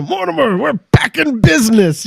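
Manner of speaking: high, excited imitation; elated movie quote. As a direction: crow it to your brother in mock triumph. Quoting an elated movie brother.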